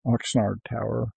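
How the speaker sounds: ordinary talk, nothing marked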